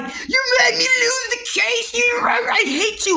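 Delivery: put-on high, wailing yell of a teen who lost; mock crying yell. The girl screaming at her after losing the case, in a high teary yell. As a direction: shriek it out like a sore loser, slow and teary. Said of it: "she's yelling".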